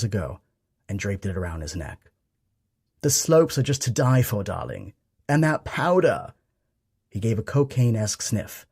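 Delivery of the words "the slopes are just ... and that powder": fakey posh accent. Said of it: "fakey posh accent, all lifestyles of the rich and famous".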